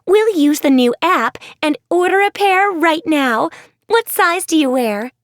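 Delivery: bubbling over with an idea; eager girl. Acted. Ashlyn to the girls, eager and bright.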